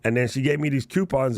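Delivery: usual speaking voice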